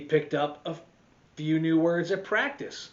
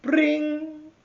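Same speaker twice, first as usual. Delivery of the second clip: high, loud ringing bring; school bell ring; 12 semitones higher, 6 dB louder than usual. Acted. Narrator imitates the school bell, high and loud.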